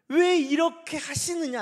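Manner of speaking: high, drawn-out protest; resentful complaint. Acted. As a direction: demand an answer from God, slowly. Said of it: "원망은".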